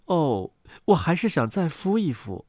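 low, soft mother duck voice; quiet, low-voiced duck mother; patient mother duck. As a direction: answer softly, set on brooding.

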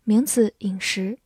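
ordinary talk, nothing marked

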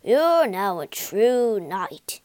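slow, loud, solemn royal voice; declaring king. A narrator's reading. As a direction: knight him with grandeur. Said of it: "he declared".

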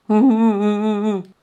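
high, pulsing mock sobs; acted sobbing. A narrator's reading. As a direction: break into an old man's staged whimpering. Old man weeps over granddaughter. (装作呜呜抽泣)

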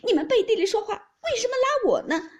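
high, loud wronged wail; crying protest. A narrator's reading. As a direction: cry out your grievance at full pitch. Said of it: "哭道". (委屈地高声哭喊)

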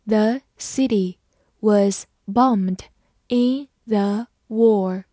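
slow, in three chunks; slow model reading for the class. Shown as a demonstration. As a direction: read it slowly phrase by phrase. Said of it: "慢慢来读".

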